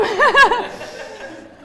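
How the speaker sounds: a quick high giggle; short laugh. Real, not acted. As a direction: giggle briefly. Laughs again.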